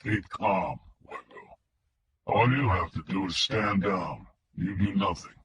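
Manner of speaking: mechanical filtered voice. A narrator's reading. Kidnapper on the phone to Wingo, mechanical filtered voice. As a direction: speak like a voice through a distortion filter. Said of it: "The filtered voice was mechanical".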